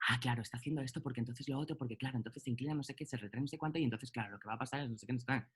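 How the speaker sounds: fast, soft mock student rattling on; rapid overthinking. Alumno que anticipa, imitado. (parloteo rápido y bajito de alumno ansioso)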